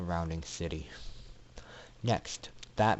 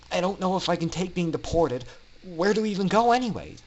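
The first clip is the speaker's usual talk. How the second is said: put-on higher, louder anxious young voice rushing at the end; worried Orion; 8 semitones higher, 6 dB louder than usual. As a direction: let the worry spill out faster and faster. Orion confesses fear of failing.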